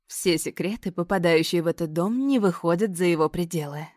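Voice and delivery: ordinary delivery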